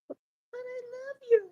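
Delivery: high, soft and hurt; talking vagina answering the man, high and hurt. Acted. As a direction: plead sweetly up high. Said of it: "But you gotta talk like that".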